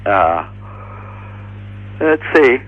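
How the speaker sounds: talking through a yawn. Real, not acted. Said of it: "Excuse me, I'm yawning here".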